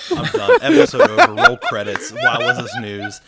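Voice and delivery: high, loud, laughing exasperation; laughing outburst. Real, not acted. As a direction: laugh it out while giving up. Mock quitting over news.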